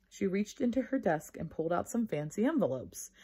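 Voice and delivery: plain reading voice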